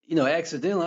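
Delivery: usual speaking voice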